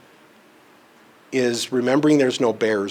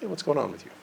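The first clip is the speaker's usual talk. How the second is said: very soft, gentle concern; hushed, unreactive gentle question; calm check-in; 3 semitones higher, 9 dB quieter, faster than usual. Retold or imitated. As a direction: ask it quietly after taking a beat. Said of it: "take a beat and go".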